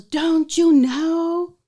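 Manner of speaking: high, loud and very slow, like a grave prophecy; loud, drawn-out dread; a stranger slowly announcing the end of the world; ominous warning. Retold or imitated. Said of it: "she goes".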